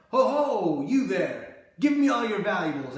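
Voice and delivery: forced theatrical hold-up demand; theatrical mugger. Retold or imitated. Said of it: "very forced and theatrical".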